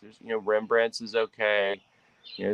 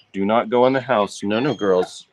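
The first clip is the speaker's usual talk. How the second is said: firm command to pets; 8 dB louder than usual. Real, not acted. Keeping the other pets inside.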